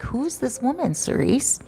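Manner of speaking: high, hushed, half-whispered asking; hushed concerned question. Retold or imitated. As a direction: lean in and ask quietly, worried. Driver quietly asking about drunk woman.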